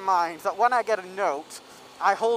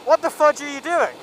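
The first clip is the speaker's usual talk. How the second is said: sharp angry question, higher and louder; angry retort; 6 semitones higher, 7 dB louder than usual. Retold or imitated. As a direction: snap it back at the waiter like a rude customer. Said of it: "the guy looked at me and he says".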